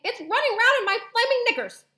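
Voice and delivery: very high, loud comic panic; hammed-up shrieking at the top of the range; panicked screaming. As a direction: scream desperately for rescue. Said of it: "the man was screaming".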